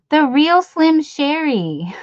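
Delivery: slow, loud, drawn-out name call; playful announcement. Pretend. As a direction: announce the name like a rap title.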